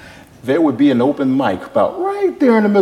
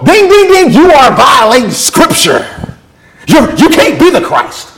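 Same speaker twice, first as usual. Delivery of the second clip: objecting Pharisees; 12 semitones higher, 4 dB louder than usual. Acted. Pharisees catch Jesus violating Scripture, high indignant objection. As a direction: sound the alarm and accuse him.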